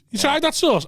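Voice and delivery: mock voice. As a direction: ape the housemate in a silly high voice. Mocking a greedy housemate's question.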